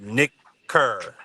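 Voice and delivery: Nick Kerr drawn out as two separate words; said slowly. Retold or imitated. Comic retelling a joke about a name, said slowly. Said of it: "say it slow".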